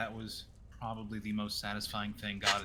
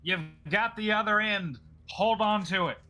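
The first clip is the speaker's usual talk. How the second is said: raised, higher-pitched shout; yelling across; 8 semitones higher, 7 dB louder than usual. Acted. Game master's character yells to a teammate holding a rope, shouting. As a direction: yell instructions to someone at a distance. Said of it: "yells instead of message this time".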